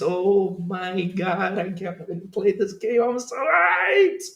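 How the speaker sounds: rising to a high, stretched squeal; hyped. Real, not acted. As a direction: build from giddy excitement to a long high cry. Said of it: "I'm just too hyped about it".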